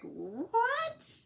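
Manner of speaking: very high rising disbelieving what; stunned what. Retold or imitated. Patient stunned by doctor's enthusiasm, very high rising what. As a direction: squeak it out, thrown completely.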